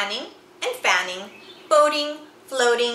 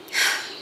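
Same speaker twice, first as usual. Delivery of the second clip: a slow, sad out-breath; long wistful sigh; 3 dB quieter than usual. Acted. Moose sighs, missing his family, a long sad sigh. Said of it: "Moose sighed".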